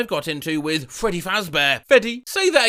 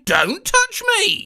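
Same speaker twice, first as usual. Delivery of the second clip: mock prissy refusal; 14 semitones higher, 3 dB louder, slower than usual. Bot refusing a hug.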